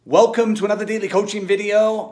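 loud, high, energetic greeting; hyped intro. Real, not acted. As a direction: pump up the opening like a host.

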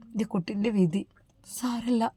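a mother swallowing her grief; whispered resignation, tearful; sad whisper. Acted. Mother weeping over husband's fate.

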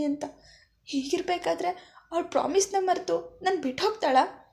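rushed desperate insistence, voice climbing; agitated outburst. Acted. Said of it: "ಎಂದು ಆವೇಶದಲ್ಲಿ ಹೇಳಿ".

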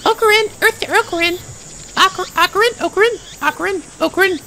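put-on high, sweet anime girl voice; cutesy girl voice. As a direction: call out brightly like a cute young girl. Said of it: "I'll give you sort of a cutesy voice".

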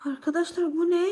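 ordinary talk, nothing marked

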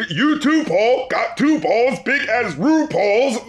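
slowly spelling out lyric; drawn-out lyric quote; slow deliberate quote. Retold or imitated. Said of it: "referencing Paul".

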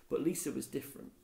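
ordinary delivery

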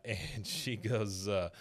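ordinary talk, nothing marked

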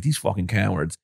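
soft, under the breath; muttered aside. Retold or imitated. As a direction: say it quietly to yourself in disgust. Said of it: "I was like".

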